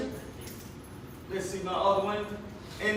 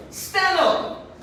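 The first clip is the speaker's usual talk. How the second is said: commanding; 8 semitones higher than usual. Acted. Jesus commanding the sick man.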